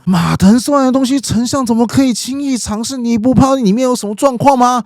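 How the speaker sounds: high-pitched nervous protest; 荀彧, alarmed. Acted. Adviser warns the chancellor about untested food, alarmed. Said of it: "荀彧就立刻紧张说".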